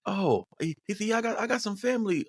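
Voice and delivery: high, faked London accent; high mock-British voice; put-on London accent. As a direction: imitate a friend badly faking a Londoner. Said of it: "trying to put on the accent".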